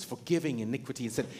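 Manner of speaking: normal talking voice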